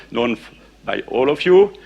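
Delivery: ordinary talk, nothing marked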